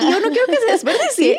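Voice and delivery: high, laughing through the words; real laughter breaking in. Real, not acted. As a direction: giggle it out as you admit it. Laughs at her own thriftiness.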